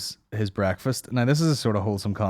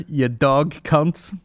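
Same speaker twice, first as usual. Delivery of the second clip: slow, louder mock Australian; mock Aussie; 5 semitones higher, 3 dB louder, much slower than usual. Proposing the podcast name in a slow mock Aussie voice. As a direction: announce it as a show title. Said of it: "There's the name of the podcast".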